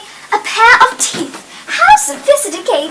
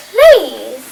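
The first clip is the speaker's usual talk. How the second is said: normal talking voice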